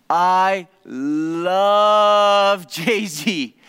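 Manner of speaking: slow, hugely drawn-out "love" in mock rapture; imitating a thrilled fan, each word dragged out; gushing love. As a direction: stretch "love" out like a fan in bliss. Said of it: "his conclusion at the end of that all was".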